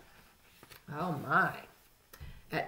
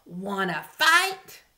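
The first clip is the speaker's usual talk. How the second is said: grouchy ladybug; 8 dB louder, slower than usual. A narrator's reading. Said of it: "said the grouchy ladybug".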